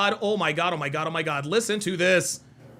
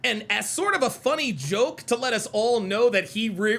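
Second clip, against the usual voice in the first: ordinary talk, nothing marked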